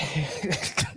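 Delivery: a short real laugh that rises; can't hold the laugh in; burst of laughter. Real, not acted. Streamer cracking up at a fighter's photo, laughing.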